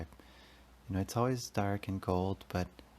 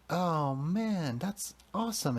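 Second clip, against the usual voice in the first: much higher, thrilled "oh man"; excited exclaim; 9 semitones higher, slower than usual. Retold or imitated. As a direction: burst out with delight at the cold water. Sudden thrill under the cold shower.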